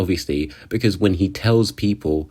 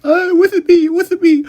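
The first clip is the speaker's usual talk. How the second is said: very high, loud girly mimicry; hammed-up impression of Amelia; mocking Amelia; 5 dB louder than usual. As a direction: squeak it like a clingy girl. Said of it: "she's been too busy, like".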